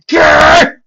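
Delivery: loud, high frustrated growl; angry snarl. Acted.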